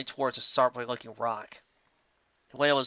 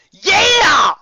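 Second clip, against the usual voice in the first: loud, high whoop; cheer; 15 dB louder than usual. Acted. Final cheers along with Lyra, very loud and high. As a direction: cheer at the top of the lungs. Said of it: "cheered Final".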